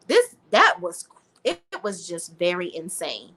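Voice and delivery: normal talking voice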